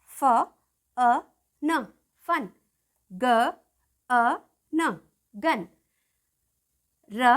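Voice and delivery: "बन" split into ब, अ, न with pauses; short u sounded out. Shown as a demonstration. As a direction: sound out "फन" piece by piece, then blend. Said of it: "यू की sound देखिए".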